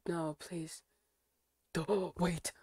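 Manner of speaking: ordinary delivery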